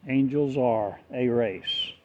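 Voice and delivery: ordinary talk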